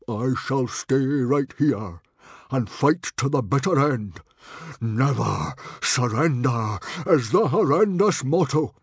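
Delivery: loud, measured proclamation of a proud chief; great chiefly dignity. A narrator's reading. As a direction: declare it grandly to the tribe, building in force. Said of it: "with great chiefly dignity".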